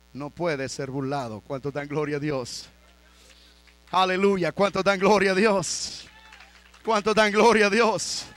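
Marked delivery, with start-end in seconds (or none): 3.9-8.3 loud call for praise; rally the room with a raised voice; rousing call. Real, not acted. Preacher asking the congregation for glory to God, rousing call.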